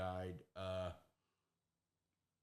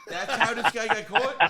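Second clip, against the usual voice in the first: high, loud, slowed by laughing; laughing disbelief; 11 semitones higher, 7 dB louder, slower than usual. Real, not acted. Listener reacting to how the guy got caught, laughing in disbelief. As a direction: crack up while asking, incredulous.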